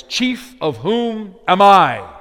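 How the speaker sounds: slow, emphatic reading with I punched; stress on I. Shown as a demonstration. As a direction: lean hard on the final I. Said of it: "all of the emphasis falls on the word I".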